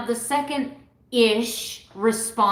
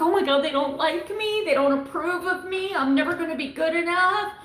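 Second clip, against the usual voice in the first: put-on insecure old self; 8 semitones higher, faster than usual. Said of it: "I reverted right back to the old [name]".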